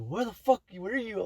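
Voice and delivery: high, mocking mom yelling; imitating angry mom. A teen imitates her mom demanding where she is, high mock anger. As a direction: mimic a furious mother. Mom voiced angrily in story.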